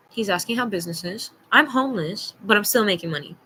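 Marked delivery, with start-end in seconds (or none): none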